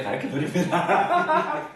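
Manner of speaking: slow words dissolving into laughter; drawn-out, laughing reassurance. Real, not acted. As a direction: promise it through a real laugh. Teacher laughs, promising to explain.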